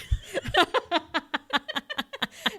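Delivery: high-pitched helpless giggling; real laugh. Real, not acted. Teller cracking up at her retelling, real laugh. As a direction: crack up at your own joke.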